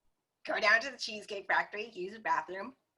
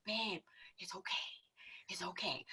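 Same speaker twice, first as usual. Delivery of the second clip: whispered, calming the angry partner; soothing whisper; 15 dB quieter than usual. Retold or imitated. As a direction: hush him gently in a whisper. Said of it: "And I was like".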